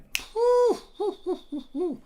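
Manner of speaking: high-pitched, hooting laugh; delighted high hoot. Real, not acted. Laughs at the dream team.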